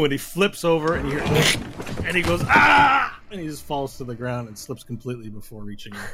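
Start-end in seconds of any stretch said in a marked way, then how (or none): 2.5-3.2 loud, high yell of a falling man; acted falling scream. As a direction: cry out as you tumble over. Falling character cries out.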